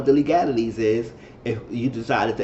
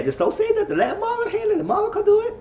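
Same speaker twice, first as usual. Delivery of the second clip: much higher mocking sing-song voice; mocking Julian. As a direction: mimic a smug man talking down to her. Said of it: "Julian shushing M J".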